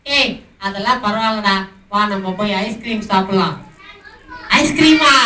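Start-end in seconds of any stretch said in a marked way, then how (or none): 4.6-5.1 high, loud delighted question; light up at the word ice cream; excited surprise. Acted. Tinku thrilled by Froggy's ice cream offer, surprised.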